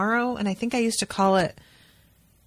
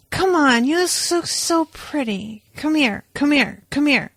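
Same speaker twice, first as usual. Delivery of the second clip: higher, needy mock-drunk pleading; imitating a drunk husband begging for a cuddle, higher; imitating drunk Owen; 4 semitones higher than usual. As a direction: whine like a clingy tipsy husband. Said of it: "Owen, when he has had too much to drink".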